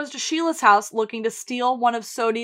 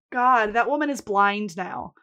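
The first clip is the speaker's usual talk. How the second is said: ordinary talk, nothing marked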